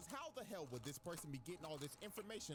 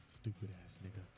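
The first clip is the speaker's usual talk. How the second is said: much lower, smug villain voice; smug inner thought; 9 semitones lower, 3 dB louder than usual. Acted. Light gloating in his head, low smug voice. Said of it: "thought in his head".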